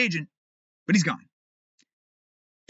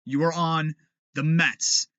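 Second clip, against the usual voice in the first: usual speaking voice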